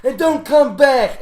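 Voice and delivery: high, loud parting shout; angry dismissal. Acted. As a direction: bark it as the door slams. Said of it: "as he says".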